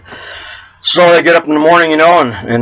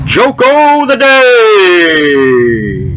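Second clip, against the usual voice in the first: very slow, sing-song segment title; grand announcer drawl; drawn-out announcer; 4 semitones higher, 4 dB louder, much slower than usual. Shown as a demonstration. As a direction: stretch every word like a show jingle. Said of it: "Joke o' the day".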